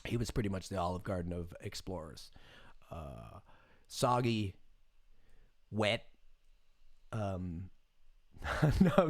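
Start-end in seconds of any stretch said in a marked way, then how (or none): none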